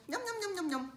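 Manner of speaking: high, playful gobbling sound; munching noises. Pretend. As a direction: imitate a little bug munching quickly. Imitates ladybug gobbling its sandwich.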